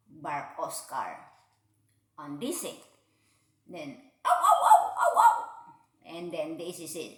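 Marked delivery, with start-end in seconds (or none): 4.2-5.4 high howling ow, ow like a dog; dog talk. Acted. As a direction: howl it like a happy dog. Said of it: "It's a dog talk".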